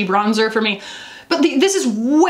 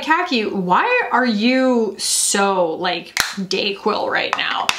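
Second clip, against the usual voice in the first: slow groggy quote; much slower than usual. Retold or imitated. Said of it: "if you're like".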